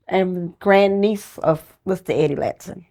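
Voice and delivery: ordinary talk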